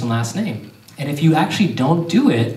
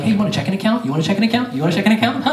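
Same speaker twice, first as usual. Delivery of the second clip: higher, pestering teller offering accounts; mock-eager bank pitch, rattled off on repeat; pitched-up peddler's patter; eager sales pitch; 6 semitones higher, much faster than usual. Bank sales pitch voiced in bit.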